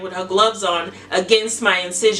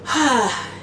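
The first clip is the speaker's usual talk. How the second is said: sigh; 3 semitones higher than usual. Real, not acted. Sigh ending the story.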